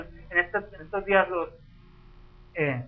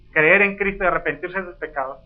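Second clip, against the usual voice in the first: usual speaking voice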